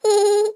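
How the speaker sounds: high, short put-on laugh; acted giggle. Boyfriend dodging girlfriend's teasing, high laugh.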